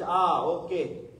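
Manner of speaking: a long, dragged-out newborn wail; a hammed-up baby cry that settles; mock baby cry. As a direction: stretch it out like a pinched infant. Baby pinched, cries then settles.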